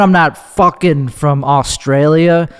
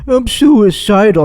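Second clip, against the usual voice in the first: hammed-up theatrical moan pitched higher; mocking drama queens who announce it, hammed-up whine; 6 semitones higher than usual. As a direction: mimic a melodramatic attention-seeker. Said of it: "one of these drama queens that goes".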